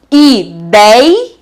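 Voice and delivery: "Ideia" split as i-dei... a; "Ideia" in separate beats with dei rising; syllable by syllable. Shown as a demonstration. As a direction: stretch dei in "Ideia", then a detached a. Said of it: "Forma um hiatozinho".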